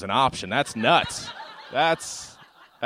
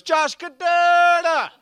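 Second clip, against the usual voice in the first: even louder, very high Jamaican holler, heavily drawn out; insistent, stretched-out Jamaican shout; purposeful Jamaican repeat; 10 dB louder, much slower than usual. Retold or imitated. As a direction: belt the name with purpose in a Jamaican accent. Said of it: "she repeated it with purpose".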